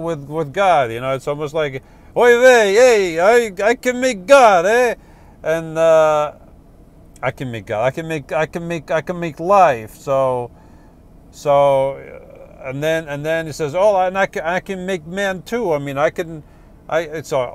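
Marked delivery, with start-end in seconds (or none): 2.2-9.9 put-on high, loud Yiddish accent boasting; kvetchy old Yiddish accent. Atheist boasting to God, "oy vey. 13.8-17.0 put-on high, boastful Yiddish accent; a touch of Yiddish accent. Atheist boasting to God.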